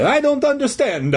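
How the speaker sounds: put-on high-pitched Italian accent; bewildered old Italian janitor; confused Italian voice. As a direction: sound baffled in cartoon Italian. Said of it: "he stammered".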